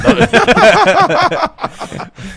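loud high burst of laughter; real belly laugh; big laugh. Real, not acted. Burst of laughter at bike question.

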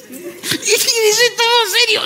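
high, slow line swamped by laughter; can barely get it out for laughing; laughing hard. Real, not acted. Host cracking up over the guest's serious reply.

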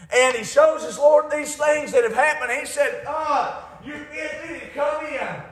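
high, loud scripture preaching; full-force preaching. Real, not acted. Preacher reading the master's call to his servant, full-force preaching. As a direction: ring the parable out high and loud.

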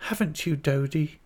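timid, high little question. A narrator's reading. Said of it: "said Dora timidly".

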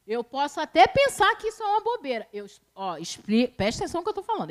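ordinary delivery

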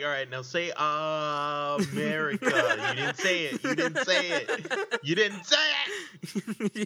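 squeaky laughing high voice, slowing down; cracking up while playing the pushy dentist; laughing mock dentist. Friends riffing on a dentist joke, high laughing mock voice. Dentist demands saying America.

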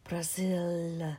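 low, slow snake hiss. Acted. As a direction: hiss the word Brazil like a snake. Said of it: "a low, hissing voice said".

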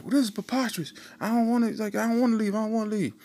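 high, hammed-up indignant whine; whiny boss. Founder protesting to security, high and whiny. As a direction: play the pompous boss refusing to leave. Papa John voiced protesting.

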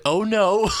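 high, loud panicked gasp of a reply; a sudden jolt of dread as the lie falls apart; alarmed oh no. Retold or imitated. Realizing the lie is exposed.

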